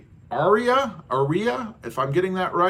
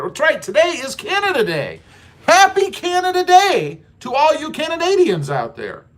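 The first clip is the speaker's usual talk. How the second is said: excited holiday cheer; 10 semitones higher, 6 dB louder than usual. Real, not acted. Cheering Canadians on Canada Day.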